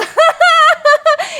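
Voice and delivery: high loud laugh; burst of laughter. Real, not acted. Streamer laughing at her own exaggeration, loud. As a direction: laugh out at yourself.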